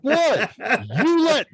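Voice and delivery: mock outrage at the principal's call; indignant surprise. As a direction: jump up in pitch, caught off guard. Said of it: "I'm like".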